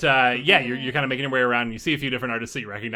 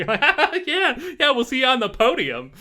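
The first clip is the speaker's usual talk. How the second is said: higher, louder voice after a laugh; laughing send-off; 14 semitones higher, 7 dB louder than usual. Acted. Rivals laughing at the challengers, loud amused send-off. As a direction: laugh it out as a parting jab. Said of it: "And they all kinda laugh".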